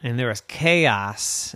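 usual speaking voice

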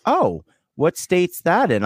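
high, slow, dopey question; mock clueless stranger. Stranger asks where Vermont is, slow and mock clueless. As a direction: drawl it out like you have no clue. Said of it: "they're like".

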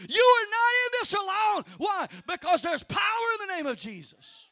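rising, loud sermon shout; full-force preaching. Acted. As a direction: drive it home to the church.

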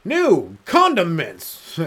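hammed-up slogan. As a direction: sell it like a cheesy commercial. Mock mint company slogan.

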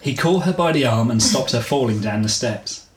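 ordinary delivery

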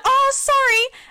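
very high, loud, hammed-up fake sorry; mock innocent apology. Imitating the queue-jumper who acted clueless, falsely innocent. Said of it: "acted like she had no idea".

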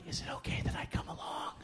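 tiny, hushed, hurried question; timid whisper. Retold or imitated. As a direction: play a shy kid asking to tag along. Timidly asking to join the group.

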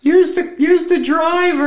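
high, slow, loud mock ghost voice. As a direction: tease him like a spooky caddie. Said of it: "There's the guy".

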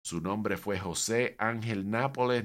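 normal talking voice

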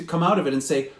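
normal talking voice

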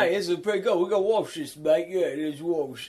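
lightly done London bloke voice; mock Cockney workman praising the graffiti; mock British accent. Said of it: "Is that how they talked".

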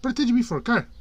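high, loud irritated question; annoyed. A narrator's reading. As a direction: complain sharply. Dunk annoyed at Egg.

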